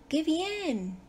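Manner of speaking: "Qué bien" lifted high, then falling; emphatic exclamation. Shown as a demonstration. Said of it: "Quiero enfatizar en la acción".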